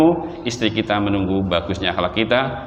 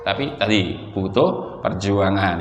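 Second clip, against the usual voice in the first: ordinary talk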